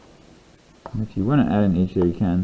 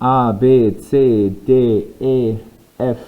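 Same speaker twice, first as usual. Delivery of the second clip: letters recited; 5 dB louder, much slower than usual. Shown as a demonstration. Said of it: "So we have".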